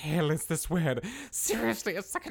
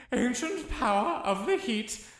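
raised, ringing incantation voice; spell incantation; 5 semitones higher than usual. Acted. Wizard casting a fire control spell, incanting. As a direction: chant it out like a magic spell. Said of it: "I have a spell of fire control".

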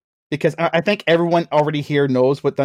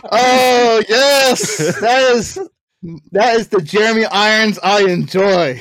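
higher, louder, emphatic relish; loud delight; 4 semitones higher, 5 dB louder, slower than usual. Real, not acted. Fan reacting to Jeremy Irons trivia, loudly delighted. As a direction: savour it loudly, drawing out the approval.

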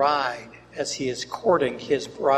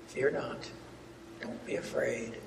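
hushed, breathy "Don't be afraid," almost a whisper; soft reassurance; 6 semitones lower, 15 dB quieter than usual. Acted. Preacher whispers God's comfort to the fearful, hushed. Quoting scripture's comfort softly.

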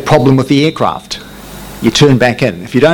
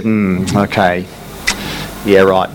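slow, lower, unconvinced hmm okay; sceptical boss; 4 semitones lower, slower than usual. Retold or imitated. Boss hearing the pilot's excuse, slow sceptical voice. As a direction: let him know you don't buy it. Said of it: "he said".

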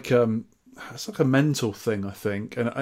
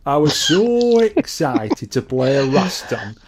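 Sol impression; 4 dB louder than usual. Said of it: "So this is our Sol".

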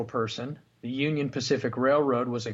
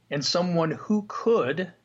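could lifted higher and leaned on; stress on could; 5 semitones higher than usual. Real, not acted. Host to co-host, stressing the word could. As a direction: lean hard on could. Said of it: "I wanna emphasize the word could".

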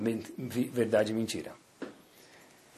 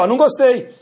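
firm, high and loud objection; assertive, not angry; 10 semitones higher, 6 dB louder than usual. Shown as a demonstration. Parent correcting a child who lied, assertive rather than angry. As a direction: state disapproval assertively without real anger. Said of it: "não bravo, ser assertivo".

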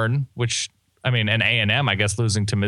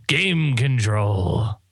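slow savoring; much slower than usual. Acted. Savoring a buzzword.

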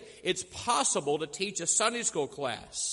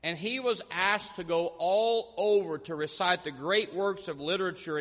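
usual speaking voice

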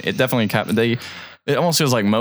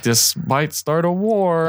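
slow, higher grave warning; ominous warning; 7 semitones higher, much slower than usual. Retold or imitated. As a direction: drag it out like a dire omen. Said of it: "And he was like".

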